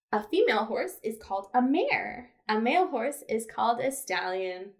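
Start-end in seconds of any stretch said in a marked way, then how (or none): none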